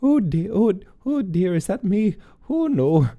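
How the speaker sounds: high, fluttery mock old-lady fretting; understated dismay. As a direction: play a politely startled little old lady. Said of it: "a slightly understated manner".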